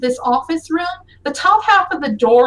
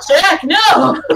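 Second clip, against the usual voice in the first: loud, raised cry of disbelief; exclaimed dismay; 4 semitones higher, 7 dB louder than usual. Real, not acted. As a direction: blurt it out loudly, about to laugh. GM reacting in mock horror.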